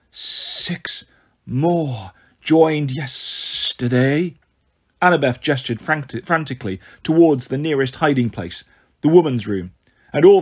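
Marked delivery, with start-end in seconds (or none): 0.1-4.3 slow hissing reptile growl; reptilian voice. Acted. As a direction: whisper it like a snake. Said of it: "a reptilian voice came".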